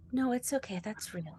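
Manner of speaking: quiet reassurance. Acted. Bethany leans over to Elizabeth, quiet reassurance. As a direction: lean in and reassure under your breath.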